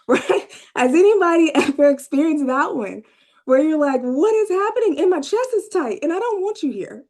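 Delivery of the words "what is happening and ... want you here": high, exasperated mock outburst; hammed-up irritable complaint, high-pitched; acted irritability. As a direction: play fed up and overwhelmed, pitched way up. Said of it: "ever just been irritable".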